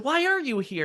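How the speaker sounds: high, loud playful retort; mock-incredulous, high-pitched quizzing; teasing question. Acting out joking with mom.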